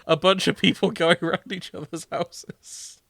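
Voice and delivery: gleefully agreeing through laughter; amused. Real, not acted.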